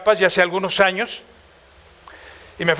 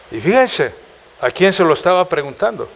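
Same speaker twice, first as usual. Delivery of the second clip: ordinary talk, nothing marked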